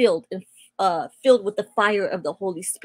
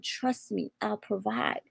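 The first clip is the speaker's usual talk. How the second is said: God's quiet voice; 9 dB quieter, slower than usual. Retold or imitated. God speaking softly to her: quiet, hushed reassurance. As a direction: murmur it gently, like a voice heard inside. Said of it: "I heard it again".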